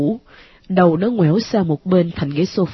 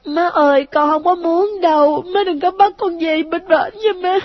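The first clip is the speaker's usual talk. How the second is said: put-on high child voice, pleading then bitter; a wounded boy begging his mom; hurt ghost child; 9 semitones higher than usual. A narrator's reading. Ghost son begs mother not to send him back, high child voice.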